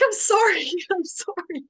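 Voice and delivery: very high, emotional pleading; distraught apology. Retold or imitated. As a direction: re-enact an upset, helpless apology. Retold tearful apology to mother.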